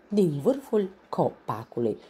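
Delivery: usual speaking voice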